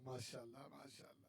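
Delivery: breathy whispered mashallah under the breath. Real, not acted. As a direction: say it to yourself in a whisper. Muttered after thanks.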